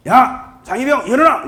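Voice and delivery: sharp loud barracks yell; loud army wake-up shout; shouted wake-up call. Retold or imitated. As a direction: bark him awake like a sergeant. Senior wakes soldier loudly.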